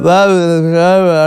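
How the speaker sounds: loud slobbery babble; mock drooling mumble, played loud; imitated drooling gibberish. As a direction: babble like a drooling man on mushrooms.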